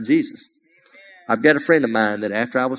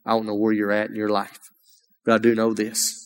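ordinary talk

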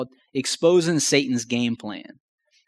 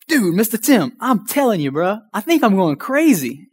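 higher, worked-up plea; exasperated venting; 6 semitones higher than usual. Retold or imitated. Telling Mister Tim about the empty gas, exasperated and higher. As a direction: sound like you truly think you're losing it. Telling neighbor he's going crazy.

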